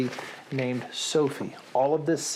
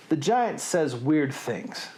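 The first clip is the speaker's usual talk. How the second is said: usual speaking voice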